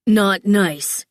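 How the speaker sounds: loud, gruff growl. A narrator's reading. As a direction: growl it to shut her down. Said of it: "he positively growled".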